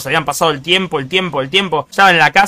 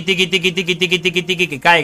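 imitated rattling; 5 dB quieter than usual. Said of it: "el ruido de tiqui".

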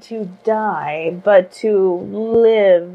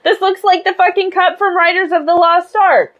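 high, indignant fairy rant; a shrill-free but much higher furious mock complaint; mock outrage; 9 semitones higher than usual. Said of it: "She's like".

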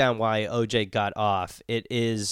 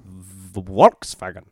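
put-on German accent; exaggerated German accent on one word; 5 semitones lower, 4 dB louder, much slower than usual. Shown as a demonstration. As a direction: punch out Volkswagen in mock German accent. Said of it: "the W's is V's".

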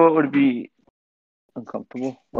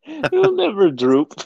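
mock vow; 10 semitones higher, slower than usual. Mock romantic vow, high.

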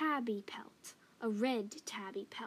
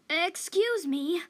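higher, louder, plucky interruption; brave; 8 semitones higher, 9 dB louder than usual. A narrator's reading. Young cat stepping up to the old cat, bravely. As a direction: stand up boldly to the old cat. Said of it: "stepping up bravely".

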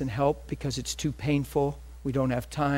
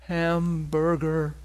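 slow, drawn-out hungry groan of a word; mock starving moan; 3 semitones higher, much slower than usual. Preacher mocking a hungry faster craving food. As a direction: drag it out like a famished faster. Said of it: "I wasn't like".

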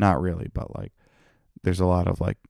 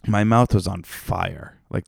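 ordinary delivery

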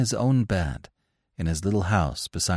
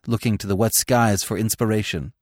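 usual speaking voice

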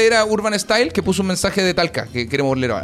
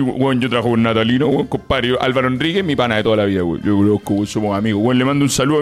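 lower, lightly voiced bragging charlatan; a hint of a name-dropping show-off, pitched lower; mock smooth talker dropping names, lower voice; charlatan impression; 7 semitones lower than usual. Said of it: "era un compadre que hablaba así".

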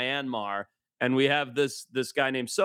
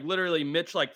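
normal talking voice

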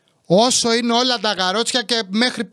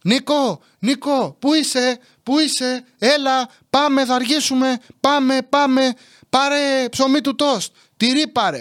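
high-pitched repeated calls; imitated calling out; 5 semitones higher, slower than usual. Mother calling her son in supermarket, mock holler. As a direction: mimic mum hollering across the aisles. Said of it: "φώναζε".

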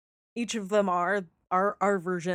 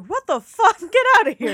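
much higher and louder, laughing mock outrage; startled what the fuck; 9 semitones higher, 8 dB louder than usual. Nadia brushes off a drunk stranger, loud startled laughing outrage. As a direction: shoo off a weird stranger, loud and incredulous. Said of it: "and she's like".

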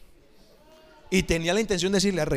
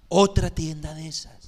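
normal talking voice

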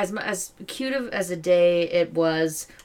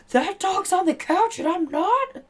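high-pitched voice for an offended dog; indignant dog; 13 semitones higher than usual. Pretend. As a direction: squeak it out like a jealous pet. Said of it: "pop the fuck up and be like".

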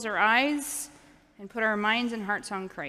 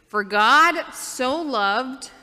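put-on slow and louder, each word stretched for the kids to copy; slow demo line; 4 semitones higher, 8 dB louder, much slower than usual. Shown as a demonstration. Pastor showing the children the signs, slow and louder. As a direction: spell it out slowly and loudly so children can sign along. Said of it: "You can say".

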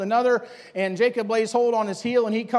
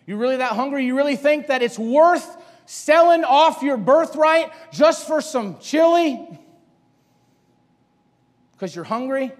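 rising insistent challenge; 4 semitones higher, 5 dB louder than usual. Acted. The Lord pressing Esau harder.

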